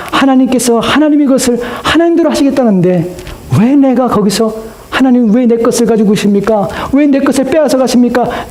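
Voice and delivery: high-pitched rhetorical protest; impassioned questioning. Retold or imitated. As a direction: voice the complaint high, as a believer reasoning with God. Preacher acts out protest to God. (높은 어조로 항변하듯)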